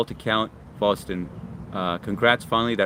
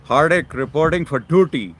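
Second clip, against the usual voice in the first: mock soldier reporting in; 6 semitones higher, 7 dB louder than usual. Reading a viewer's comment playfully.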